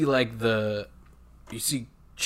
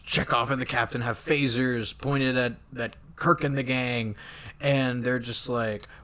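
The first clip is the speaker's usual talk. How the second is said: usual speaking voice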